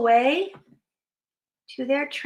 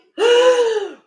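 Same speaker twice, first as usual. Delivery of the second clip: high, loud gasp of shock; startled gasp; 10 semitones higher, 5 dB louder than usual. Acted. Hippo finds the Yeti, big startled gasp. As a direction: gasp sharply at a sudden discovery.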